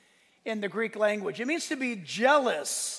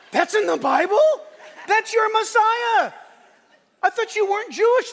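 triumphant shout; 11 semitones higher, 9 dB louder than usual. Retold or imitated. Preacher tells the seller about his Messiah, shouting. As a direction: declare it like a revelation. Climax of retold exchange.

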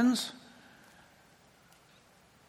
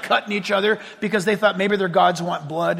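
usual speaking voice

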